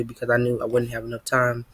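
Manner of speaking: normal talking voice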